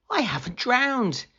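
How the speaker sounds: high, astonished exclamation; surprised. A narrator's reading. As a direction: Jonah amazed to be alive inside the whale. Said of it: "he said in surprise".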